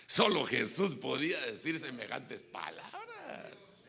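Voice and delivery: loud, slow emphasis; preaching awe. Real, not acted. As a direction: lean on every word in awe. Asombro predicador. (asombro fuerte y lento)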